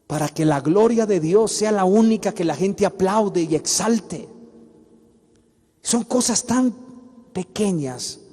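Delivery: ordinary talk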